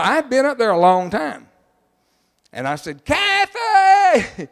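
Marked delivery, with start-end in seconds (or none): 3.0-4.5 high shout of her name; calling out to his wife, startled shout. Retold or imitated. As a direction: yell the name across the house. Calling wife from another room.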